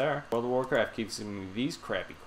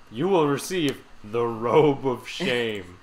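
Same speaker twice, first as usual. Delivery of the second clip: mock announcer; 7 dB louder, slower than usual. Reads quest reward mockingly.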